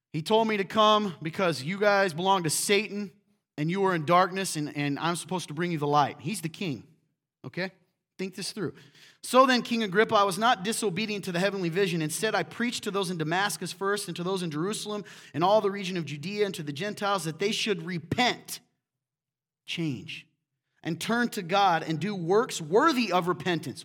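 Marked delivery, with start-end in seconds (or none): 0.1-6.2 loud, emphatic sermon delivery; preaching. Acted. Preacher voices Paul boldly before the king. 9.2-23.8 louder, emphatic scripture read; preaching. Acted. Preacher reads Paul's speech to King Agrippa with fervor. Reading Paul's defense aloud.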